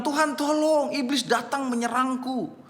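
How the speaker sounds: high-pitched mock cry for help; a young man calling out to God at night, high-pitched mock cry; retold cry for help. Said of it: "si pemuda ini berteriak".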